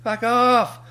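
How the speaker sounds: high, loud dismissive shout; mock-triumphant high yell; retold taunting yell. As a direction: yell it at the chasers, reenacting the moment. Acted taunt at chasers.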